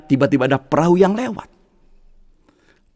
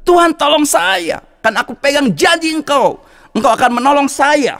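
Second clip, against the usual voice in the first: high, insistent plea; desperate plea; 10 semitones higher than usual. Acted. Man on the roof begging God, high insistent plea. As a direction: hold God to his promise.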